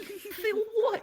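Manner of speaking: very high, mock-panicked question; nervous. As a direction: act out squeaky bewilderment. Nervous reply to the guide.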